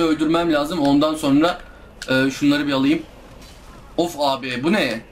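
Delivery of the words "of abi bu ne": ordinary delivery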